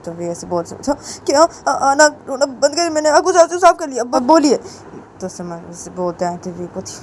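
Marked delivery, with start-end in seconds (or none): 0.8-4.6 high, insistent student voice; impatient Samar. A narrator's reading. Student assures his teacher the tears are wiped, high and insistent. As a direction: hurry the reassurance out in a higher voice.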